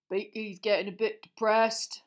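a gentle giant, gloomy; Hagrid voice. Acted. Gamekeeper telling the children about his beast, glum.

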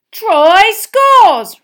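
loud high roar. A narrator's reading. Bagman roars the goal to the cheering stadium. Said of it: "roared Bagman".

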